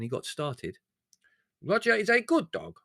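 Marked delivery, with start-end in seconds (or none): 1.6-2.8 high, loud defiant insistence; belligerent. Acted. The maid defends the dog against the owner: high fierce insistence. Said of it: "said Lugorezia belligerently".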